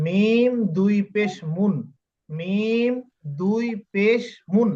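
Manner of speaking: slow, long-held vowels in each letter name; drawn-out spelling drill. Shown as a demonstration. Teacher modelling how to spell out mun, slow drawn-out drill. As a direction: recite the spelling slowly, piece by piece.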